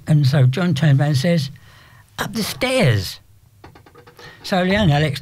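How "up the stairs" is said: slow explanation. Retold or imitated. Explaining the slang slowly.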